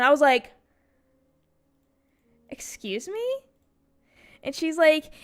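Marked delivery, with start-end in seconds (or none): 2.5-3.4 high, rising incredulous question; acted-out affronted double take; offended disbelief. Taken aback by her friend's criticism, offended disbelief. Retold offended reply to classmate.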